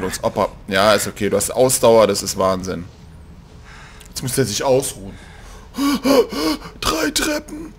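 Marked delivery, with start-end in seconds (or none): 5.8-6.8 mock gasp. Character out of breath.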